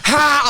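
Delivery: high, forced single laugh; mock laugh. Answering an insult like Rocket in Guardians, mock laugh. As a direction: fake a cartoonish taunting laugh. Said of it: "you know the rocket from".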